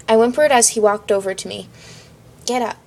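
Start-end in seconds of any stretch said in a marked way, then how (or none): none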